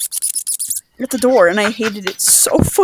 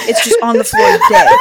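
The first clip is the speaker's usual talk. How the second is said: laughing; 4 dB louder than usual. Real, not acted. Telling the punchline, laughing.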